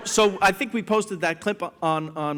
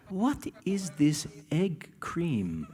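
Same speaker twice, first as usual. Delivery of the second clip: curious slow question; 6 dB quieter, much slower than usual. Retold or imitated. Ladies lean in, curious.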